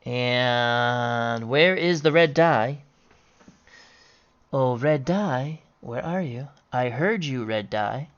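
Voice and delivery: low, soft, drawn-out calling; coaxing search call. Pretend. Player calls to the dropped red die, soft coaxing. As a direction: softly coax a lost thing out of hiding.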